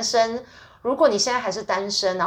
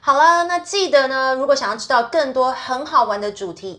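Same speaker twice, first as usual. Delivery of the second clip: podcast outro; 4 semitones higher, 4 dB louder than usual. Acted. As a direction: wrap up the show like a cheerful host.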